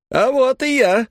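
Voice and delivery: high, loud showman's cry; loud shout. A narrator's reading. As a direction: burst onto the stage shouting. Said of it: "громко крикнул хозяин".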